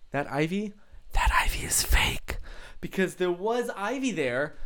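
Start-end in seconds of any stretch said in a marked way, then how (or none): 0.1-2.4 confessional stage whisper; secretive whisper. Pretend. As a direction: whisper it like letting them in on a secret. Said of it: "let you in on a little bit of a secret".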